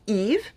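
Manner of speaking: "Yves" in French, said eev with no S. Shown as a demonstration. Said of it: "You do not pronounce the S".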